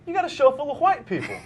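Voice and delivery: put-on high, piping little boy voice; little kid voice. Host mimics a kid on the street, high little boy voice. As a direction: squeak it like a small child tugging a shirt. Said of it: "And he said".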